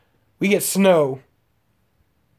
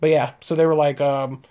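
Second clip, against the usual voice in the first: usual speaking voice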